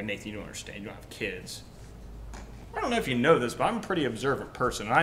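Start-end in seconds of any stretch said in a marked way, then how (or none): 0.0-1.6 lower, softer whiny objector voice; imitating a doubter in the pews; mock complaining parent. Pastor mimicking a defensive parent, lower and softer. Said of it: "Now you sit there and go".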